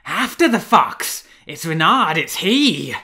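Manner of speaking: high hunting yell, words drawn out; shrill, powerful cry. A narrator's reading. Man setting the hunt on the fox, shrill powerful shout. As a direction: raise the alarm, loud and piercing. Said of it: "as shrill as it was powerful".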